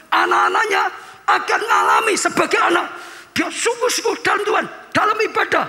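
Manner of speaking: high, shouted sermon climax; preaching at full force. Acted. Pastor to congregation, shouting sermon climax.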